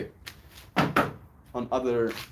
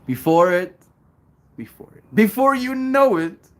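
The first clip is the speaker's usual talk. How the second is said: loud; 11 semitones higher, 10 dB louder than usual. Real, not acted. Excited shouted opening of message.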